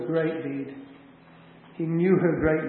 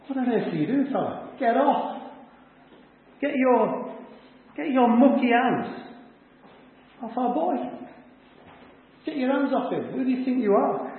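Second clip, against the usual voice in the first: higher-pitched, outraged protest; indignant relatives; 7 semitones higher than usual. Acted. Widow's folks tell a stranger to get off their boy, indignant. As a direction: warn off a stranger in acted indignation.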